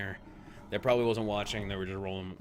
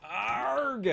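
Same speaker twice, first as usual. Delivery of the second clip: high frustrated growl; frustrated groan; 3 dB louder than usual. Real, not acted. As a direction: groan in annoyance. Missing in game.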